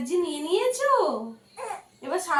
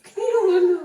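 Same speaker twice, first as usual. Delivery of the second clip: ordinary talk, nothing marked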